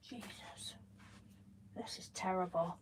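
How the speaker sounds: under the breath; whispered. Real, not acted. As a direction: whisper it to yourself. Muttered to herself, unvoiced.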